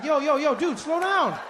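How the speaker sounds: high-pitched acted concern; concerned brother. Acted older brother calming crying child.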